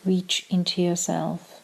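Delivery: calm meditation voice with long pauses; short, measured phrases, leaving room to breathe; guided meditation. Acted. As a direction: guide them gently into stillness, unhurried.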